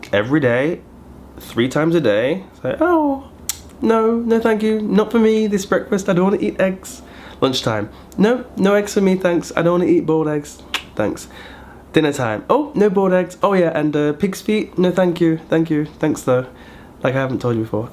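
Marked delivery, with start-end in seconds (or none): 2.6-7.0 high overly polite mock self; sweetly polite refusal. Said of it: "imitating her own refusals". 8.2-11.3 higher mock-polite decline; polite refusal. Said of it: "repeating refusals at lunch". 12.5-16.5 higher mock-polite decline; polite refusal. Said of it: "repeating refusals at dinner".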